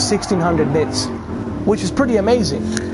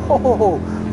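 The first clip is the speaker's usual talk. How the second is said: short laugh; 6 semitones higher than usual. Real, not acted. Laughing after the crash.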